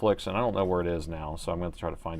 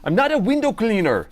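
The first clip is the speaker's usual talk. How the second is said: high, loud put-on Irish-ish voice; quoting the sitcom in a character voice; IT Crowd quote; 11 semitones higher, 7 dB louder than usual. Streamer quoting IT Crowd to chat, high put-on voice. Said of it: "I T Crowd".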